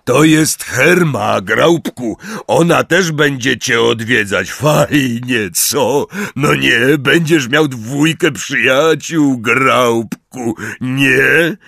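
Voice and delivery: high, loud, hearty calling; loud coaxing introduction. A narrator's reading. Hagrid promising the giant two friends, loud coaxing. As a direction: coax a big simple listener, loudly.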